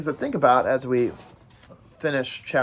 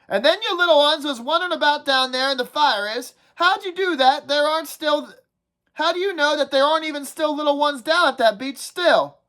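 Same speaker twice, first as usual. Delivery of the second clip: put-on higher-pitched fretful boy, scolding; whiny schoolboy nagging the group, pitched well up; a fussy, higher kid's voice complaining about the fire; Piggy's voice; 11 semitones higher than usual. Piggy voiced in read-aloud.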